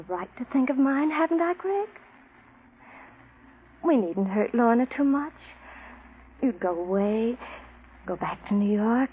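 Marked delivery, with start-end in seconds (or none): none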